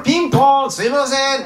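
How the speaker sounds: high, loud polite caller; mock doorbell caller. Jesus jokingly imagined ringing the bell, high polite voice. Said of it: "とか言って".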